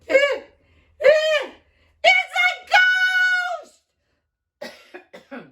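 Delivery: high shrieking stammer of fright; terrified shriek. Acted. Fishermen on the boat shrieking at a ghost. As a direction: shriek it like you've seen a ghost.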